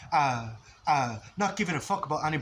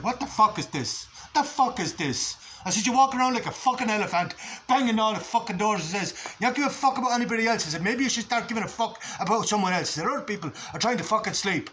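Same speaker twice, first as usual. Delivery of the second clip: louder, higher, furious telling-off; angry confrontation; 5 semitones higher, 3 dB louder than usual. Retold or imitated. Speaker retelling shouting at flatmate banging doors, angry. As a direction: give him both barrels. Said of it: "both barrels".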